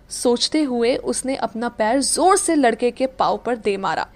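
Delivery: plain reading voice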